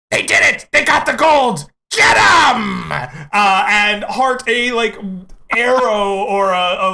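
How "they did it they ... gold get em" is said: high, loud alarm-raising yell; attackers' shout. Acted. Enemy spots the gold and calls the attack, shouting. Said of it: "a voice goes".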